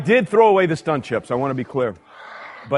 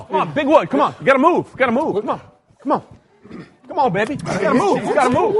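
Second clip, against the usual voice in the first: self-hype; 5 semitones higher, faster than usual. Real, not acted. Cheering himself on through heat.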